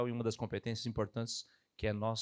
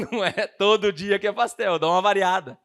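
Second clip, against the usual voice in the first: laughing joke; 6 semitones higher, 10 dB louder than usual. Real, not acted.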